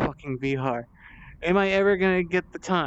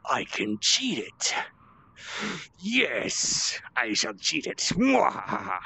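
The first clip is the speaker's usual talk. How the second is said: sneering panto villain; 4 semitones higher, slower than usual. Pretend. Gleeful villain plan to cheat.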